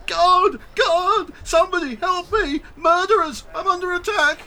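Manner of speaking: very high, loud terrified shrieks; panicked screaming. Acted. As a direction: scream for help as if being murdered. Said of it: "He just starts screaming".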